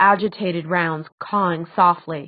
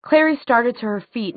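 ordinary delivery, nothing marked

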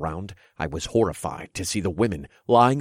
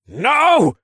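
anguished scream; 11 dB louder than usual. Acted. King screams.

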